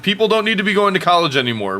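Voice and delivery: "don't" rising high, fast and excited; excited outburst. Pretend. Rant restarts louder.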